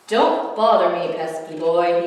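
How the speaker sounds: loud booming warning; bellowed. A narrator's reading. Theodore warns the pesky boy, loud bellow. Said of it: "he bellowed".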